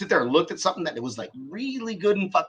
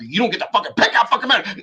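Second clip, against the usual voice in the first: fast, louder, higher mock rage; angry outburst; 6 semitones higher, 5 dB louder, faster than usual. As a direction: spit it out quickly, as if losing it. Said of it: "and you're like".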